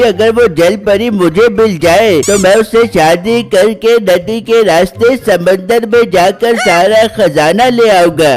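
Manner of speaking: usual speaking voice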